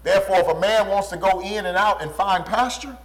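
ordinary delivery

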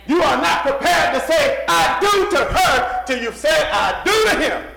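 loud, driving pulpit delivery; full-force preaching. Real, not acted. Preacher charging young men to love God first, full force. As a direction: exhort the congregation at high volume.

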